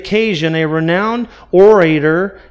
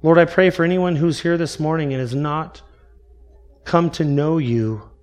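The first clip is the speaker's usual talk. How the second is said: prayer. Acted. Leads a closing prayer.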